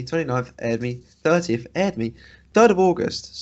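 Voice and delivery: ordinary talk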